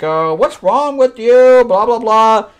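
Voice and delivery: high, loud, drawn-out fretting; hammed-up high-pitched parody; mock alarmed father. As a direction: imitate a father overreacting. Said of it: "And her father's like".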